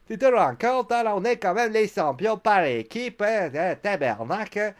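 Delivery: rotten, loud mock Québécois accent. Sami Zayn complaining with his tag partner, in a bad Québécois accent. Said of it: "mon accent est québécois est pourri".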